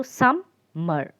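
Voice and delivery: "summer" slowly broken into sum and mer; split into syllables. Shown as a demonstration. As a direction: pause between the two syllables of "summer". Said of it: "taking two breaths for this word".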